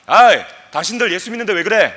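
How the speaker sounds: loud, mock-taunting call; leading a shout. Preacher leads the congregation in a loud mock taunt. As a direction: shout it out like a heckler. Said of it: "다 같이 한번 외쳐봅시다".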